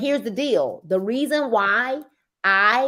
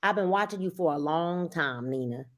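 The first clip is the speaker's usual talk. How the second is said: soft customer quote; 6 semitones lower, 8 dB quieter than usual. Retold or imitated. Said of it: "message me say".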